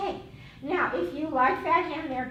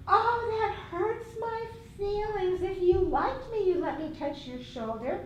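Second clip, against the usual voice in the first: guilt-tripping adult; 6 semitones higher than usual. Acted. Teacher acts a guilt-tripping adult.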